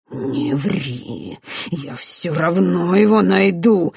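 menacing threat. A narrator's reading. Director warns the girl he will find the amulet, menacing. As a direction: stretch the threat out, building force. Villain's threat in the acted quarrel.

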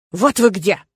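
mildly angry, firm outburst; angry bark. A narrator's reading. Aik finding the two women, mildly angry bark. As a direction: confront them with a touch of anger. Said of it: "рявкнул на нас Айк, злой как чёрт".

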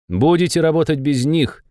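ordinary delivery, nothing marked

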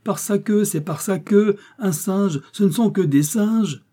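very high, hilarious disbelief; high-pitched delighted outcry; amused exclaiming. A narrator's reading. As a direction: exclaim high, as if bursting with mirth. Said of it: "s'écria Joey en éclatant de rire".

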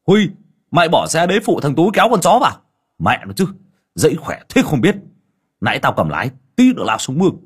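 high, fast shouting call across the yard; old man calling out loud and high. Acted. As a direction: bellow at the boy to come help. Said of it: "Tiếng của ông Lý gọi".

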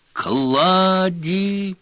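high, urgent yell of a name; desperate shout. A narrator's reading. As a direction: shout it, fearing the worst. No answer, calls again louder.

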